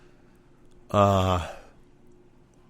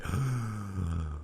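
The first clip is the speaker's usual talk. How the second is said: mock gasp; 3 semitones lower, 10 dB quieter than usual. Mocking zombie crowd's reaction.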